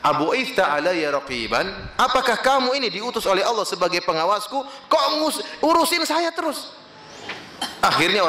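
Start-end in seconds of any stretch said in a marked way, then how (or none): 0.0-6.7 exasperated retort. Retold or imitated. Sinner, fed up, rounds on his adviser, climbing high and exasperated. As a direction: push back harder and higher each phrase. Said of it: "yang terakhir dia mengatakan".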